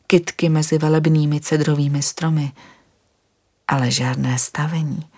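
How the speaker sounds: usual speaking voice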